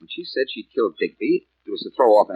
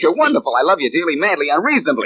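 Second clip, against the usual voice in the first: playful gush; 6 semitones higher, 5 dB louder than usual. Acted. Blackie gushes at Mary.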